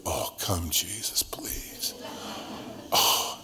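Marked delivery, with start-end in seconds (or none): 0.1-1.9 whispered plea. Pretend. Pleading prayer at the mirror.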